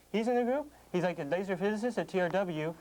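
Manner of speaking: usual speaking voice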